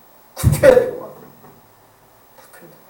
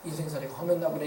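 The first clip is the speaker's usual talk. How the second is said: pitying mourner; 3 semitones lower, 12 dB quieter than usual. Retold or imitated. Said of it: "이런 소리 들으면".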